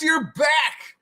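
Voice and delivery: loud, high, happy welcome back; excited greeting. Real, not acted. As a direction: call out to a friend returning. Greeting a returning viewer.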